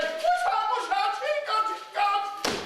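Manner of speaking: high, sing-song rooster call; cockerel crowing. Acted. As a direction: crow it out like a cockerel.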